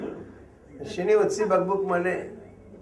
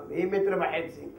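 ordinary talk, nothing marked

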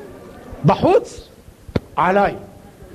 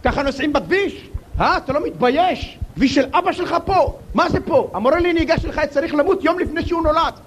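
raised pitch, fast angry tirade; road rage rant; 6 semitones higher than usual. Retold or imitated. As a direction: tell off the other driver, fast and high. Driver yelling at other driver.

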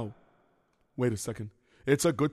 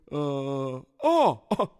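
usual speaking voice

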